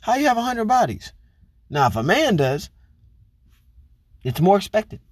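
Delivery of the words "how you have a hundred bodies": high, disbelieving mock question; incredulous. Man hearing woman's past, mock incredulous. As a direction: act shocked at the number. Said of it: "you're thinking".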